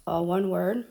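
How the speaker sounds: ordinary delivery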